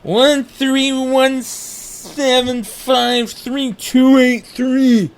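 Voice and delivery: put-on slow, loud, high drunken recital of digits. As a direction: drag each number out like a drunk. Drunk reciting number slowly.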